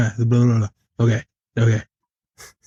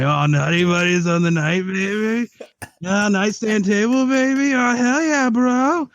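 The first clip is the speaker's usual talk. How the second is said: high, squealing stoked voice; excited stoner guy; 8 semitones higher than usual. Retold or imitated. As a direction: get giddy over a snack. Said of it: "banana nut bread at work guy voice".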